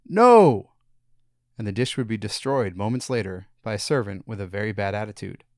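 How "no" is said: very loud, high refusal. A narrator's reading. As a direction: boom the refusal out. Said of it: "everyone would hear a loud".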